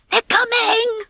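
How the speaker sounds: high-pitched, wailing warning; alarmed cry. A narrator's reading. Agnes warns the chickens of the Tweedies, crying out. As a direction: warn everyone in fright. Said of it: "cried Agnes".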